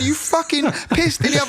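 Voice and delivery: very high, exasperated re-enactment; heated retold accusation. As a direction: escalate the acted accusation, pitched right up. Acts out repeated accusation.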